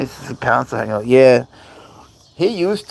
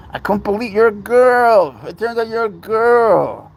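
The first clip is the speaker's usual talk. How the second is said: pet talk; 11 semitones higher, 7 dB louder than usual. Real, not acted. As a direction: tease the cat fondly. Addressing cat Butler directly.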